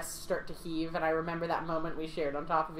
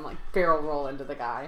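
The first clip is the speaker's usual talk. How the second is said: ordinary delivery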